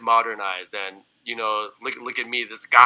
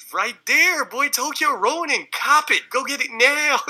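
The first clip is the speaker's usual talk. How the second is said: high, hyped-up shoutout; hype shout; 14 semitones higher, slower than usual. Acted. Hyping the album.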